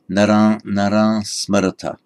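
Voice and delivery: naran with a nasal vowel, no m or ng; correct nasal naran. Shown as a demonstration. Teacher confirming the right nasal naran. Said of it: "pronounced by a northern Indian today".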